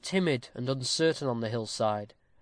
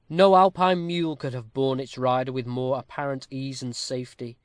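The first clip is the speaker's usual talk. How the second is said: ordinary delivery, nothing marked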